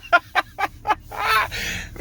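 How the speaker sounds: high, breaking-up laughter; uncontrolled giggling fit; real laugh. Real, not acted. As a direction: burst out laughing at your story. Cracking up telling story.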